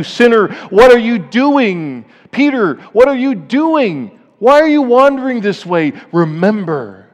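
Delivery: God calling. Acted. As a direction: call out to the wandering sinner with urgency. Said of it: "I want you to hear God calling you".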